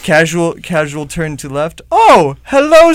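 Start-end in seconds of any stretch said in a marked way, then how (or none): 1.9-2.9 loud, high, drawn-out greeting; mock surprise. Host pretends to find a guest in the room, exaggerated surprise. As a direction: feign a startled discovery. Acts out stumbling on a stranger.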